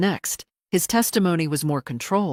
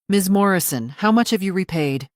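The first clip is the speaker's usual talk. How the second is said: louder, insistent demand; firm, louder repeat; 4 semitones higher, 8 dB louder than usual. Acted. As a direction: press the question again, louder. Lawyer repeats the unanswered question.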